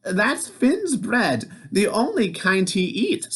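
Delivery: high, slow innocent voice; Oogna's voice. A narrator's reading. Wife feigning innocence to giant, high slow voice. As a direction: play innocent. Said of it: "said Oogna".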